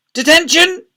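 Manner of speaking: high, loud punishing shout; furious shout. A narrator's reading. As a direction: shout it like an enraged deputy head. Enraged deputy head hands out punishment.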